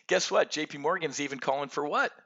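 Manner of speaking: ordinary talk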